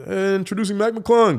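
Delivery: high, sustained announcer's introduction; arena announcer. Retold or imitated. Dunker mimicking the announcer introducing a contest player, mock announcer call.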